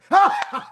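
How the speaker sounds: high burst of laughter; high, open laugh. Real, not acted. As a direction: burst out laughing after the bit. Laughs after own impression.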